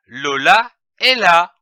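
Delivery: "Lola est là." read in one go, voice dropping at the full stop; one smooth breath, falling at the end of "là". Shown as a demonstration. Teacher modelling the sentence for a child, falling at the end. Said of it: "Il faut lire cette phrase d'une seule traite en baissant la voix au point".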